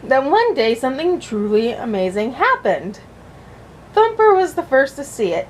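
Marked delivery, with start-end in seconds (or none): none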